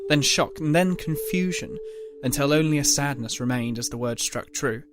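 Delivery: ordinary delivery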